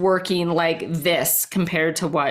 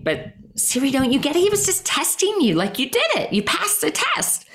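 imitating mom in a much higher voice; high-pitched, insistent reassurance as mom; encouraging mom; 9 semitones higher than usual. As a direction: play a mother cheering on her kid, pitched way up. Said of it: "I remember her saying".

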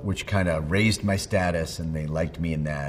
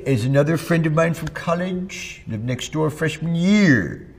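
high-pitched, louder character voice; Comic Book Guy; 9 semitones higher, 5 dB louder than usual. Acted. Telling of the college friend behind the character, in high character voice. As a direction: stretch line ends in a higher put-on voice. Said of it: "That was the basis of Comic Book Guy".

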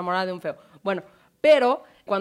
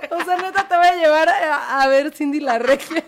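mocking disbelief; 6 semitones higher, 6 dB louder than usual. Real, not acted. Mocking the date idea.

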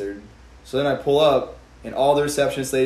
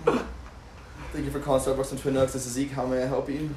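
phone greeting; 8 dB quieter than usual. Shown as a demonstration. Barista answering the store phone.

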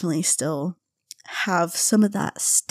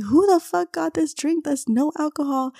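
interrogating friend; 8 semitones higher than usual. Retold or imitated.

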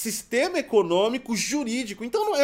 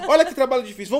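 laughing; 4 semitones higher, 8 dB louder, faster than usual. Real, not acted. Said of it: "repeated, louder".

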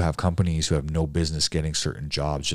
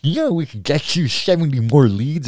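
high, pushy sales pitch voice, a good deal higher and louder; young salesman impression; 10 semitones higher, 6 dB louder than usual. Young lead salesman pitching to a painter, high and pushy. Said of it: "a young kid embraces me like".

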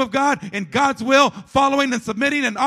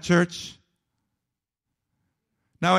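usual speaking voice

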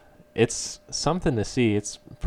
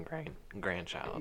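voice cracking; 12 dB quieter, slower than usual. Real, not acted. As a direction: voice cracks on grandchild. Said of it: "Voice cracked".

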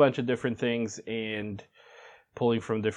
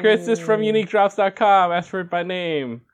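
chipper 1950s ad; 8 semitones higher, 6 dB louder than usual. Pretend. Repeats the show slogan.

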